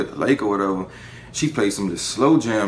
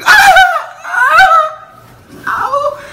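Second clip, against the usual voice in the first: high, loud mock porn-star moans; imitating a porn-star moan; 11 dB louder, much slower than usual. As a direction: ham up her high moaning. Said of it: "I'm not gonna do the moan".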